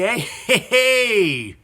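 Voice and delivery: cheeky Irish brogue; hammed-up Irish accent. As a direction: show off the Irish accent with a grin. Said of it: "my Irish accent is better than Tom Cruise's".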